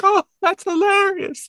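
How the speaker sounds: high, slow mock laugh track; exaggerated canned audience reaction. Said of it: "the laugh track is just like".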